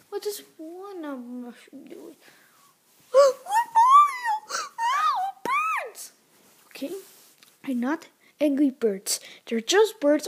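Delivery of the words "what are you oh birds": high-pitched play-acted panic; panicked cry. Mario spots the birds bearing down, panicked cry. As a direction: cry out in pretend alarm at something awful.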